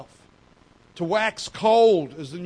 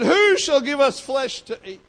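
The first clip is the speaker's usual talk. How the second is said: higher and louder whiny complaint, imitating the grumbling crowd; hammed-up moaning for meat; mock moaning; 7 semitones higher, 6 dB louder than usual. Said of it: "they got to moaning".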